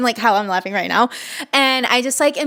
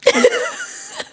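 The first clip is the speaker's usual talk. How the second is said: very high teary laugh; laughing while welling up; tearful laugh. Real, not acted. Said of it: "get so emotional".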